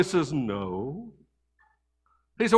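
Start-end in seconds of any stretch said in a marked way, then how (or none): 0.3-1.1 put-on small, hushed child's hello; a timid kid picking up the phone, hushed; quiet little boy. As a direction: answer softly like a shy little boy. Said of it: "real quiet and soft".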